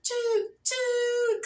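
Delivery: very high choo-choo whistle; high, sustained train-whistle call. Pretend. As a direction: toot it like a toy train whistle. Train whistle after the chugging.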